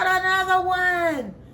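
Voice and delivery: high, stretched-out mock cry; acted alarm. Woman reporting yet another bug to hubby, alarmed. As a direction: hold the high note, then let it drop.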